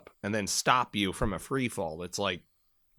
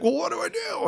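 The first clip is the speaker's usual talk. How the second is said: much higher, panicky imitated voice; panicked buddy. Shaken Marine on the cliff asking for help, imitated panic. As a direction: squeak it out like a scared man on a rope.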